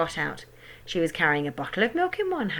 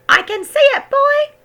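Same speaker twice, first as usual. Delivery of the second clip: high, loud crone call; shouting grandma; 10 dB louder than usual. A narrator's reading. Grandma shouting back down to George. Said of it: "the old girl shouted back".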